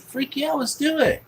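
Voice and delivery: high-pitched, eager agreement; excited yes. Retold or imitated. Boy accepting the restaurant invitation, excited yes. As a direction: retell a kid jumping at a treat. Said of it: "I'm just sitting there fucking excited".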